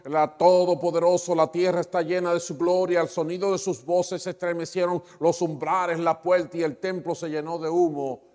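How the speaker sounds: fervent preaching. Acted. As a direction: proclaim the angels' cry with full force. Preaching Isaiah's vision at full force. (predicación fervorosa y en voz alta)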